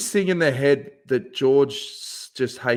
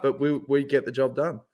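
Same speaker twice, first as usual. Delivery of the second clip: ordinary talk, nothing marked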